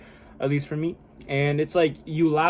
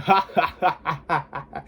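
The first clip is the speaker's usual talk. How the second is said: ugly passionate laugh. Shown as a demonstration. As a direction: laugh ugly and wavy, give it everything. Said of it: "You want it to sound ugly".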